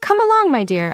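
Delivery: high-pitched, grand coaxing opening; theatrical villain. A narrator's reading. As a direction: sweep her along with a high lilt, settling on "dear". Villain scoops up sobbing woman.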